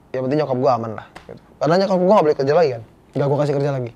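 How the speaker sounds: ordinary delivery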